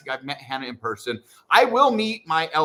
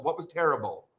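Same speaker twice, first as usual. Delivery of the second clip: ordinary delivery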